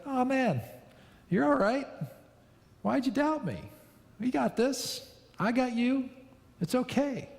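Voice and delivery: high, light and reassuring; a father catching his son, laughing gently; supportive, joyful Jesus. Retold or imitated. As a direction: lift him up, smiling, with a laugh in the voice. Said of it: "almost with a laugh in his voice".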